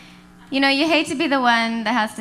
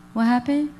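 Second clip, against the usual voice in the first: vacant what-happened. Retold or imitated. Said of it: "something I hear all the time".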